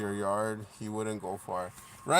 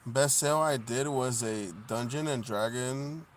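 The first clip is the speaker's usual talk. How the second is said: ordinary talk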